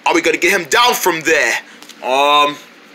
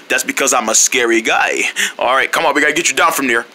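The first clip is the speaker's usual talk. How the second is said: ordinary talk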